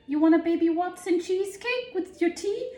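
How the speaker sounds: a hint of a posh old lady, high; lightly voiced grandmother offering cake; grandmother impression. Grandmother offering cheesecake with tea, light impression. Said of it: "I can't even do the impression".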